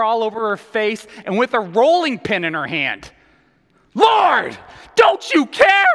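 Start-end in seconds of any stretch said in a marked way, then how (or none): 3.9-5.9 high, loud frantic shout; Martha losing it. Acted. Floury Martha with rolling pin, losing it at Jesus. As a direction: shriek it in sheer exasperation. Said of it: "woman with the rolling pin who's losing it".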